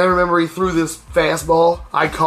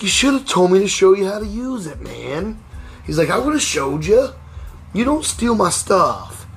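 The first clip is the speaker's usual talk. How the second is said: scolding; 6 semitones higher than usual. Retold or imitated. Uncle scolding the boy.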